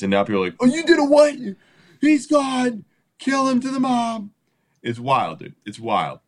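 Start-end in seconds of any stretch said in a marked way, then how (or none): 0.6-4.3 very high, squealed mock outrage; mock outraged mob. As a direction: play a hysterical mob in falsetto.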